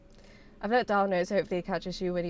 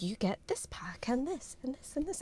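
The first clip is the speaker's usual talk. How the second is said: unvoiced whisper reading the offer; whispered readout; 5 semitones higher, 8 dB quieter than usual. Streamer reading the game's bonus offer aloud, in a whisper. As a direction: breathe the words out with no voice.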